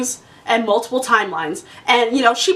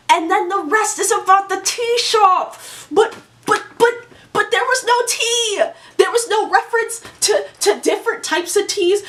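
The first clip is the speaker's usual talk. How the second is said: pitched up, frustrated rant; real exasperated rant; 6 semitones higher than usual. Real, not acted. Reviewer ranting to viewers in real frustration. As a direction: vent about a book that let her down. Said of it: "I'm crashing out right now".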